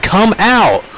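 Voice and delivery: high, loud come-out taunt; calling out a hiding player. Real, not acted. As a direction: yell at the enemy to come out. Taunting hidden enemy player.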